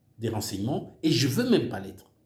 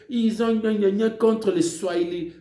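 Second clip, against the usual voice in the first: mock blah-blah, louder and higher, sneering; mocking gibberish; 5 semitones higher, 4 dB louder than usual. Mocking Kabila's claims about Swahili speakers with gna-gna scorn. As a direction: parrot Kabila's nonsense with scorn.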